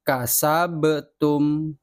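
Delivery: "Kasabtum" very slow, the b released with a bounce, "kasa-be-tum"; bouncing ba. Shown as a demonstration. Teacher models the bouncing ba for pupils, very slow. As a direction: let the b in "Kasabtum" rebound. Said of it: "Harus ada pantulan".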